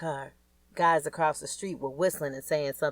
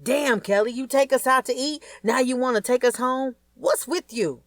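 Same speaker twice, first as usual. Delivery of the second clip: higher, louder exasperated dad; a father scolding his daughter, louder and higher; dad annoyed she ends dinner, higher, louder; upset dad; 8 semitones higher, 4 dB louder than usual. Acted. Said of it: "I could tell he was upset".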